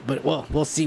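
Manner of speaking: ordinary talk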